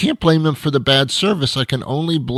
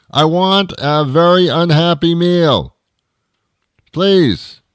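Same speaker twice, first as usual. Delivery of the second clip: higher, louder stressed order; angry order; 6 semitones higher, 5 dB louder, slower than usual. Acted. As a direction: bite out the order in fury. Ordering angrily at speaker.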